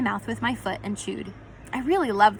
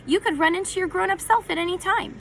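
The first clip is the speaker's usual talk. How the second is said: proud bark; 10 semitones higher than usual. A narrator's reading. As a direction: shout it like you've cracked the case. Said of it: "as he barked and said".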